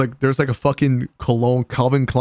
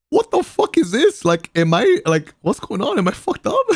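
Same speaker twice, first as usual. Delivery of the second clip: shocked disbelief; 11 semitones higher, 4 dB louder than usual. Retold or imitated. Retold shock at bathroom attendant.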